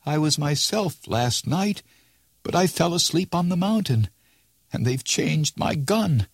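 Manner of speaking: put-on lost old man pleading to be recognized; bewildered Rip Van Winkle. A narrator's reading. Old Rip to the crowd, confused and pleading.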